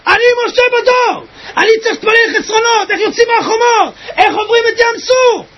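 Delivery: זעקה. Retold or imitated. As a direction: cry out pleadingly from the depths, high and sustained. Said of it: "והוא צועק". (זעקה גבוהה וממושכת)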